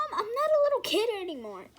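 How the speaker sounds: high, loud offended protest; indignant protest. Acted. Daughter protesting to her mom, high indignant. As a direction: huff it out, insulted.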